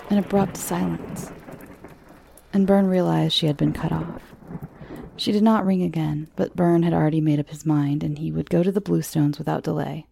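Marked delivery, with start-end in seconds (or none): none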